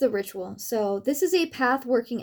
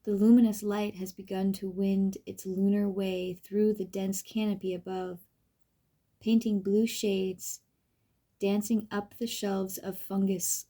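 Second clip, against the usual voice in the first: soft, unhurried meditation voice with long pauses; guided meditation; 3 dB quieter than usual. Acted. Reading a pathworking exercise to the listener, guided meditation. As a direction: gently lead the listener through the enchanted forest.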